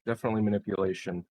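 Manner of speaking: usual speaking voice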